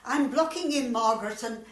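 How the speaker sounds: loud and pushy; pushy insistence. Acted. Competing member claims the job, loud and pushy. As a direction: insist loudly on going.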